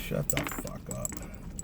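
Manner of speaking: slow unvoiced whisper; whispered mutter. Real, not acted. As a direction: whisper it under your breath. Muttered at phone assistant.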